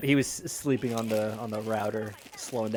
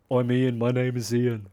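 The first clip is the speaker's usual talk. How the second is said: bad Ian impression. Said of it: "my famously bad Ian impression".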